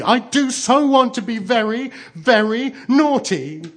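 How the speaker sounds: high, loud wail, dragging the last words; hammed-up childish bawling; bitter sobbing. A narrator's reading. Turkey wanting to be very naughty, sobbing bitterly. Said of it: "sobbed the turkey even more bitterly".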